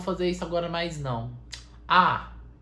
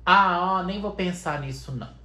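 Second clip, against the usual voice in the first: ordinary delivery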